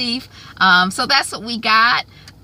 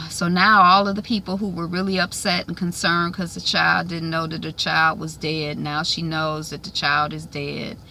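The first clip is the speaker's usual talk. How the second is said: ordinary talk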